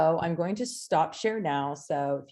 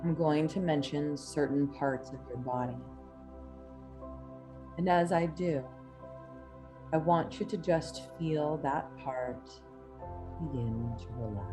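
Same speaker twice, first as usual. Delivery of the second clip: hypnotic induction; 5 dB quieter than usual. Acted. Reads hypnotic induction over music.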